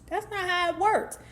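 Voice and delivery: high-pitched exasperated insistence; exasperated telling-off. Real, not acted. Ranting at the absent author.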